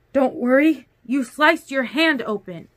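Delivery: high, loud, disbelieving echo; incredulous. A narrator's reading. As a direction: throw the words back in alarm. Incredulously echoes his brush-off.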